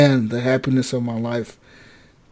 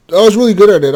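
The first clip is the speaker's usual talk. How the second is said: louder, higher playful brag; proud boast; 5 semitones higher, 7 dB louder, much faster than usual. Real, not acted. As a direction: crow about being good at it. Boasting about game skill.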